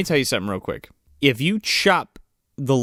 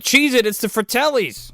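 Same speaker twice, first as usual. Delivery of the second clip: higher-pitched mock panic call; mock alarmed warning; 9 semitones higher than usual. Movie character warning friends of villains, mock alarmed. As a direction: raise the alarm, hammed-up.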